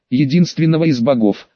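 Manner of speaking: ordinary delivery, nothing marked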